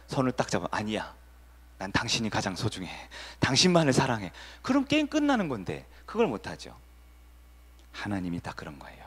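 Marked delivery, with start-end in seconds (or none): 0.7-4.3 soft, gentle vow of love; tender reassurance. Shown as a demonstration. Pastor demonstrating the right answer to a hurt wife, soft and tender. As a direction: hold her hand and reassure her softly. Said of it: "답은 뭐예요?".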